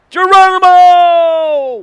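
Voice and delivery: long, loud and high battle cry; jump shout. Acted. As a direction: yell it as you leap. Jumping into the river.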